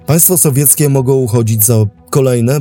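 usual speaking voice

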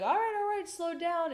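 high, hurried soothing doctor; doctor calming. Retold or imitated. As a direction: ease the mother down. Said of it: "And she's like".

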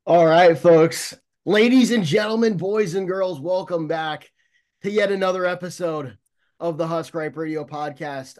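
big booming show opener; announcer voice. Acted. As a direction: hype up the crowd like a radio host. Podcast intro to listeners.

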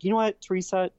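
sassy rhetorical challenge; mock confrontation. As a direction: call her out, incredulous. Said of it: "I was like".

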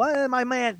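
high-pitched mock pal greeting; mocking the commentators. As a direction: toss it off like a chummy broadcaster in a put-on high voice. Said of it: "As soon as they go".

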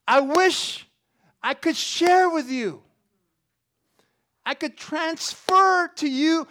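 loud, high, fervent preaching; full-force preaching. Acted. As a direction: drive Paul's words home to the congregation. Preaching Paul's letter with force.

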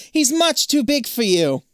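high, loud, slowed-down shout; shouted warning. A narrator's reading. Zoe warns you about the gargoyle, shouting. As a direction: call the warning across the water. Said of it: "Zoe shouts from the pool".

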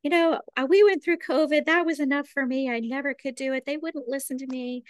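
high-pitched mock parent excuses; put-on fretful protest, pitched high. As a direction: imitate parents pleading that they can't. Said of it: "so many parents, I think, would say".

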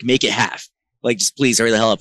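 stressed bandleader shouting orders; loud urgent demand. Retold or imitated. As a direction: bark at him to hurry. Rushing guitarist before the set.

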